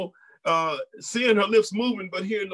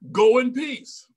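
raised, ringing blessing; priestly blessing; 6 semitones higher, 5 dB louder, slower than usual. Retold or imitated. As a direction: send her off with authority. Eli blessing Hannah.

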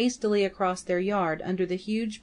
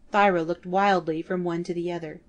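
usual speaking voice

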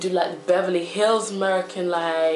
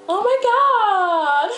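high, drawn-out Beverly Hills whine; Beverly Hills American; 14 semitones higher, 5 dB louder, much slower than usual. Acted. Girl discovers her broken nail, appalled Beverly Hills American. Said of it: "Beverly Hills American".

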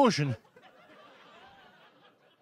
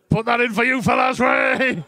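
ordinary delivery

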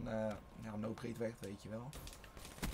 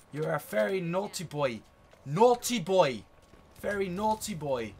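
put-on English "naughty boy" voice; mock scolding; 6 dB louder, slower than usual. Gamer to opponent he dislikes, mock scolding. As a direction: scold playfully, drawing the words out.

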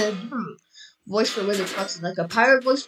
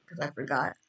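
ordinary talk

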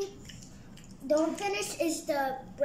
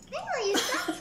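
high, laughing hang on; laughing protest; 8 semitones higher than usual. Real, not acted. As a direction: playfully stop them, laughing. Playful scuffle over chicken.

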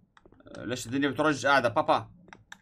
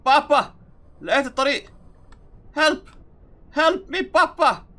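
higher, louder calls, half laughing; mock call for help; 11 semitones higher, 9 dB louder than usual. Boy in the game yelling to dad, laughing. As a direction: shout for dad with a grin.

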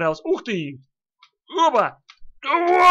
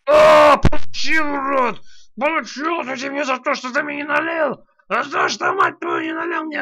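angry shout; 6 semitones higher, 5 dB louder than usual. Pretend. Voicing drunk character's revenge.

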